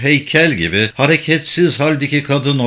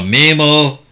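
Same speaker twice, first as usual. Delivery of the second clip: louder, drawn call of the name; shouted call; 3 semitones higher, 5 dB louder than usual. A narrator's reading. Pino calling his brother who gives no answer, shouting. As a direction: call out for someone lost. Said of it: "diye bağırdı Pino".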